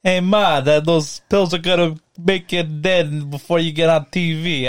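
a bad Brooklyn Jared Leto impression; Brooklyn accent. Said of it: "You wanna try?".

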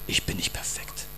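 a breathy whisper of doubt; whispered self-doubt. Retold or imitated. Preacher voicing the believer's doubt, whispered. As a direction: whisper it like a thought you are ashamed of.

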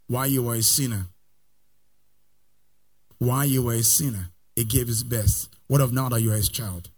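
soft, low pleading phrases; quiet intensity. Acted. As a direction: pull them in close and low. Quiet build-up.

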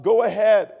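high, loud dare; mock shout at God; defiant yell. Yelling at God.

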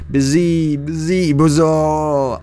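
high loud whistling wind; howling wind. A narrator's reading. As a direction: howl like a storm.

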